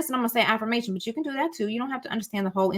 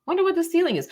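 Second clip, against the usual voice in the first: curious inner voice; 9 semitones higher, 3 dB louder, faster than usual. Shown as a demonstration. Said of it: "It's saying".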